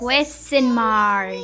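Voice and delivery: slow, loud, drawn-out "Question mark"; modelling word. Shown as a demonstration. Teacher modelling the answer, slow and loud. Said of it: "This is a question mark".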